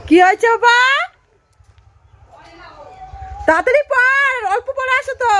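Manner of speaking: high, loud call across the house; calling out to son. Real, not acted. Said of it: "এই যে ডাকছে". (ছেলের ডাকে সাড়া দিয়ে উঁচু গলায় জোরে ডাকা)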